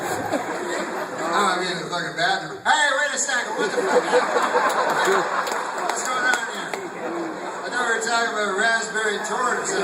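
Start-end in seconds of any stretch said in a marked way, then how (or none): 2.6-9.8 high, loud burst of mock panic; alarmed protest. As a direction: call a halt, utterly baffled. Diner suddenly objects to waiter's seduction.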